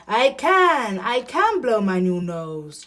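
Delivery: loud, exultant elephant cry; trumpeted joy. A narrator's reading. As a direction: proclaim success at full blast. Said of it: "trumpeted Elephant".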